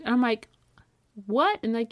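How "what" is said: high, loud what; disbelieving yelp; jaw-drop surprise; stunned what. Retold or imitated. Apartment approved.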